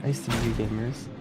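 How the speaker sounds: ordinary delivery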